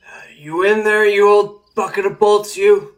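put-on loud slurred drunken greeting; drunk slurring. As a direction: slur it like a happy drunk. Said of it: "drunkenly and slightly slurred".

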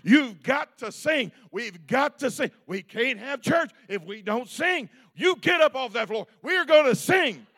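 loud, high, angry commands to get up and sing; re-enacting a furious pastor yelling at his flock; angry pastor shouting. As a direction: bark at the congregation to get up off the floor. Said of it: "I was a mad pastor".

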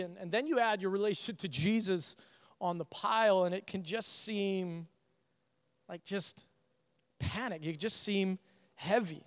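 soft, reflective sermon voice; quiet preaching. Acted. Pastor turns from the story to the message, soft reflective preaching. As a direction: draw the room in, hushed and earnest.

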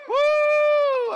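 loud, high whoop. Acted. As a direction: whoop with joy.

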